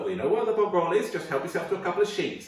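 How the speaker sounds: lightly voiced offhand mother; mum answering. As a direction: toss it off like a busy mum.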